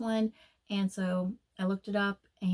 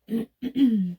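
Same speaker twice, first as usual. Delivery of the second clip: loud throat clear; 2 semitones higher, 6 dB louder than usual. Real, not acted. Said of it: "Side note, I'm having really bad problems with my throat".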